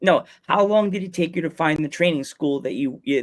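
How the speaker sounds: ordinary delivery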